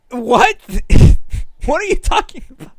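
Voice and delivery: high, loud disbelief; shocked loud laugh-edged what; baffled outburst. Real, not acted. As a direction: react like you can't believe it. Baffled reaction to brain rot.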